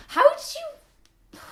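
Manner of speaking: very high, loud, slow disbelieving squeak; amazed squeak. Real, not acted. Amazed question to viewer.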